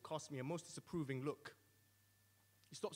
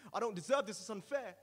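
desperate protest; 5 semitones higher, 8 dB louder than usual. Acted. Man protests in hell.

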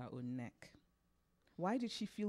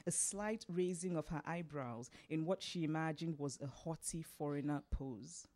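plain reading voice

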